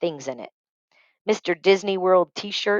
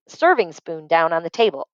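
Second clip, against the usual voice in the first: ordinary delivery, nothing marked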